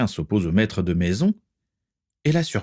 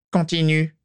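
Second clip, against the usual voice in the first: commanding; 10 semitones higher than usual. Acted. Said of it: "ordonna-t-il".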